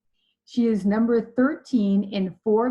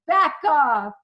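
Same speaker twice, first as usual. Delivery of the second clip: loud, higher-pitched retort to a stranger; sudden exasperated raised voice; upset outburst; 6 semitones higher, 6 dB louder than usual. Retold or imitated. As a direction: snap it loudly at someone crowding you. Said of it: "I was so upset".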